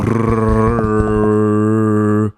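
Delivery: long rolled-r drum roll. Pretend. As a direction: trill the r like a snare roll. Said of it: "roulement de tambour".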